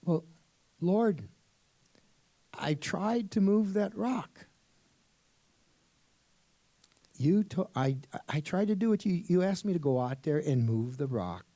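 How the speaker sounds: a frustrated man complaining to God; desperate George. Acted. George protests to the Lord, loud and pleading.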